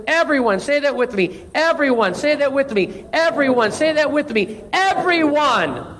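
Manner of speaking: high-pitched, emphatic exhortation with falling phrase ends; impassioned preaching. Real, not acted. Preacher tells church everyone is God's masterpiece, impassioned.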